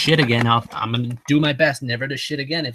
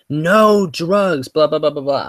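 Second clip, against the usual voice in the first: higher, louder mock yell; mock doctor yelling; 4 semitones higher, 4 dB louder, slower than usual. Surgeon yelling rules at his patient, mock shout. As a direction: bark it like the doctor again. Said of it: "he was like".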